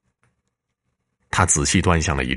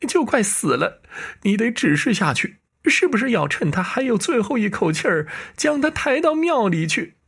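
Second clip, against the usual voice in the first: much higher woman's voice, urgent and worried; anxious wife. Acted. Wife waking her husband in the garden, anxious and high-pitched. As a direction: shake your husband awake in alarm.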